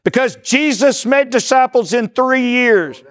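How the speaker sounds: high, slow, grand proclamation; mock-solemn pronouncement. Teacher jokingly answers why twelve months, mock-pompous proclamation. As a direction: draw it out as the grand official answer.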